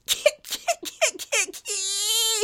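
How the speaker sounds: high-pitched wheezy cackle; cackling laugh. A narrator's reading. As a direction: cackle in rapid bursts up to a high squeal. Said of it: "He laughed".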